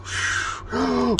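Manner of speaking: high, breathy startled gasp; sharp gasp. Real, not acted. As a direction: gasp in alarm. Print about to break.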